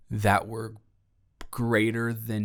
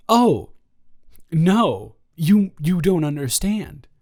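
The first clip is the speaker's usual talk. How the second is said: Tony insisting; 4 semitones higher, 6 dB louder, slower than usual. Retold or imitated. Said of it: "And he said".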